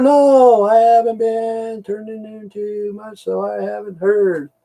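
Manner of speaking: high, slow put-on voice; mock voice. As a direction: drag it out high and slow like a fake innocent reply. Retold reply in put-on voice.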